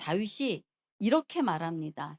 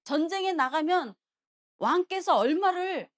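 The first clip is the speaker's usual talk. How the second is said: David's attention-seeking clamour; 7 semitones higher, 3 dB louder than usual. Retold or imitated. David making a commotion for the king to notice, clamouring. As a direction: call out so the whole camp hears. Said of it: "이러면서 막 소란을 피우는 거예요".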